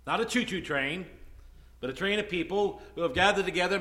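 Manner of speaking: ordinary talk, nothing marked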